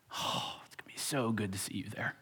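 soft eager whisper; hushed delight at seeing a friend. Retold or imitated. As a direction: breathe it out like a happy secret. Friend voiced warmly.

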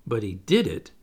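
usual speaking voice

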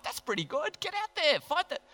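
high-pitched playful egging-on; mock-exasperated urging. Preacher playing recruiter, urging the army out to fight, mock-exasperated urging. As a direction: urge them on in a high, comic plea.

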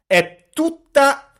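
ordinary delivery

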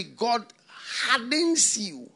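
ordinary talk

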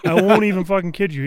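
usual speaking voice

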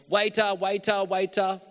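high, loud repeated call; calling the waiter. Retold or imitated. Ignored diner calling again for the waiter, loud high call. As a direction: shout over the room for the waiter. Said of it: "They just keep ignoring you".

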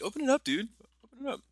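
high-pitched mock eager urging; high, teasing imitation of a friend; imitating the cohost. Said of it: "usually you're like".

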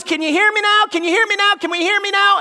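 high, insistent repeated call; calling out to be heard. Acted. God calling to people who are not listening, insistent. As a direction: call out as if unheard. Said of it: "God says".

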